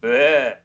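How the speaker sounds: loud bleh of disgust; disgusted by taste. Acted. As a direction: spit out a yucky taste. Echoing gross joke.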